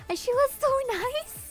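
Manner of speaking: very high, fast, squeaky. Real, not acted. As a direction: crank the pitch way up, giggly. Pitched-up voice, far timbre.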